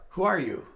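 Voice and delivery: puzzled and curious, leaning on "are"; puzzled who are you. Retold or imitated. Ronnie wondering aloud who he is talking to. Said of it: "It was like".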